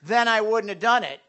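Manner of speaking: loud, accusing comeback; mock indignant retort; blaming excuse. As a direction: push the blame back on them. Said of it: "you're responsible for your own sin".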